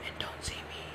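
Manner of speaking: hushed whisper while hiding. Real, not acted. Kid hiding in the game whispers to stay unheard. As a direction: breathe it so nobody hears.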